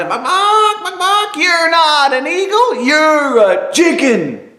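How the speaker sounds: shrill squawk ganging up on him; shouting chickens. Acted. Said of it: "ganged up on him in unison and shouted".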